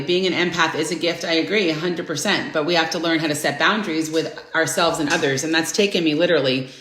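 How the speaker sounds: normal talking voice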